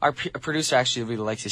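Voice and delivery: ordinary talk, nothing marked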